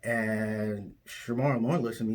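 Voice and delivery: normal talking voice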